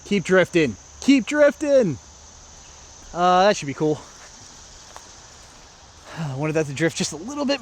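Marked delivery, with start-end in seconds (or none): 1.0-2.0 higher, insistent call to the disc; will it onward, pitch leaping up; urgent coaxing. Real, not acted. Urging disc again, more insistently.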